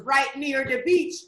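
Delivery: slow, lightly put-on Jamaican accent; drawn-out mock Jamaican answer; fake Jamaican accent. Answering where he lives, slow fake Jamaican accent. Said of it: "trying to convince Samson that he's Jamaican".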